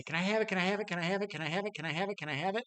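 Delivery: put-on whiny childish pleading that fades; begging kids. Kids begging mom and dad for the puppy, whiny and high. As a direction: nag mom and dad, high at first then dwindling.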